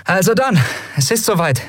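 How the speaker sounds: high-pitched, purposeful leader; Endriel briefing the crew. Acted. Endriel tells the crew it is time, high purposeful voice.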